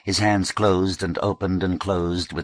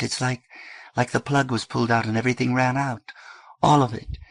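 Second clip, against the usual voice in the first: put-on higher, worried girl's voice; June pleading; 6 semitones higher than usual. A narrator's reading. June begging her brother, mildly anguished. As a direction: press it on him, halting but firm.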